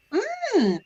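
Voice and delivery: high, soft polite hum; a token hum for show; faked interest. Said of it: "I'm already faking it".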